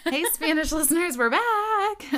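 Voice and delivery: high, loud cheerful call-out; upbeat announcer hello; excited greeting. Real, not acted. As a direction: greet the listeners with a burst of energy. Hosts greeting Spanish listeners.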